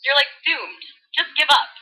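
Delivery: put-on bubbly valley girl voice; valley girl retelling scripture. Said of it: "in full Valley Girl mode".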